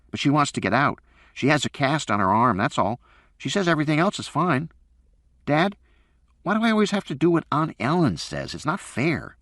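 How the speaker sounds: put-on it's so unfair, says the kid; whining boy. A narrator's reading. Son whining to his dad about Aunt Ellen, aggrieved.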